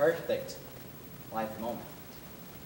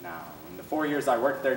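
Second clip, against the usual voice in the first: ordinary talk, nothing marked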